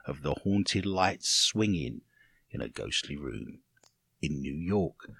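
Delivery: spooky hush. Acted. Spooky delivery of haunted room.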